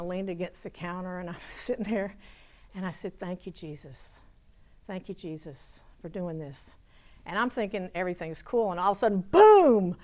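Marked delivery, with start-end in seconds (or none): 3.2-6.6 soft, private little prayer; quiet thanks. Retold or imitated. Woman alone thanking Jesus after he leaves, softly. 9.2-10.0 loud, high, explosive burst; sudden boom. Retold or imitated. Storyteller startling listeners with a slammed door, sudden and loud. Imitates door blasting open.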